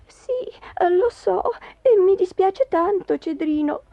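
high, plaintive wife; plaintive, sighing remorse; sorrowful apology. Acted. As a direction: apologise with real regret in a high voice.

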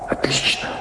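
soft voiceless whisper. Acted. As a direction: breathe it barely aloud. Quiet aside during a reunion.

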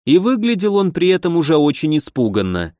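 ordinary delivery, nothing marked

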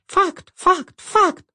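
very high squeaking chorus; squeaky tiny voices. Acted. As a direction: squeak like tiny creatures inside a belly. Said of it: "Все они пищали". (тоненький писк)

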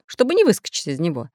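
ordinary delivery, nothing marked

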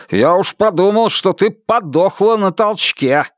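a mocking tyrant husband; Buster sneering. A narrator's reading. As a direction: sneer it at her. Buster mocks his wife.

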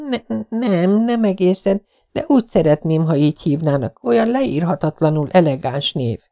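put-on a romantic child daydreaming; dreamy young girl. A narrator's reading. Anne tells Marilla she wishes for the name, wistful.

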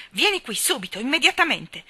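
loud, rising to immediatamente; an impatient command building in volume; urgent shouted order. A narrator's reading. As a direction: hurry him with rising force. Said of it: "gridò a Deacon".

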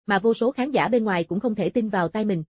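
plain reading voice

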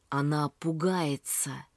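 plain reading voice

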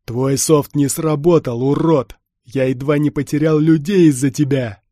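angry growl. A narrator's reading. Heavy stormtrooper berates the man who sold him software, growling. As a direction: growl it out hard and loud. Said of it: "рычал штурмовик".

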